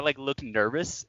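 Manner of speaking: usual speaking voice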